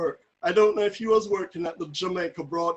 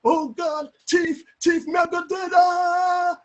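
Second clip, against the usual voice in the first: high, loud screams for help, ending in a wail; terrified shouting; 11 semitones higher, 7 dB louder, slower than usual. Retold or imitated. Terrified neighbour banging on her window, shouting for help. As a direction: cry out in terror, much higher and louder. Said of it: "terrified. She was banging on the window".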